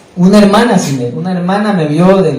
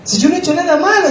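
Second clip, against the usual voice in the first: naive protest; 12 semitones higher than usual. Retold or imitated. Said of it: "decía así, mira, la bobera de uno".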